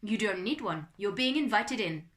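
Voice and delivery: loud French butler; French-accented butler, firm reply. A narrator's reading. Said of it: "It was a French accent".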